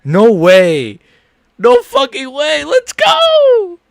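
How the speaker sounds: loud, rising disbelief into joy; dawning joy. Real, not acted. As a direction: let the win sink in, then erupt. Fighter just won by sub.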